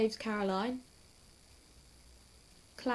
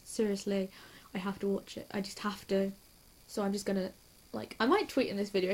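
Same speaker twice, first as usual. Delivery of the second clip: normal talking voice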